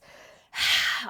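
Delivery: big loud sigh through the mouth; forceful sigh. Shown as a demonstration. Teacher exhaling with the class, loud forceful sigh. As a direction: push the breath out hard.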